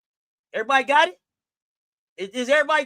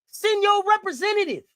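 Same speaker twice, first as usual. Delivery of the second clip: shouted demand; 6 semitones higher than usual. Real, not acted. Telling rival fans to send a representative, shouting. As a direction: call it out like a dare.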